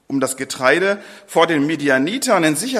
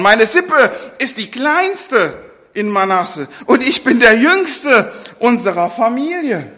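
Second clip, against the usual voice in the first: higher, louder and slowed, with a little laugh; protesting Gideon; 6 semitones higher, 5 dB louder than usual. Acted. Gideon insists he is the youngest, loud protest. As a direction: plead your smallness half laughing.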